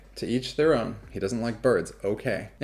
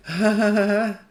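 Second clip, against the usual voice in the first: mock nervous ha-ha, high-pitched; high, put-on nervous titter; imitated nervous laughter; 11 semitones higher, 3 dB louder, slower than usual. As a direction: imitate an audience laughing uneasily. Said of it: "Nervous laughter".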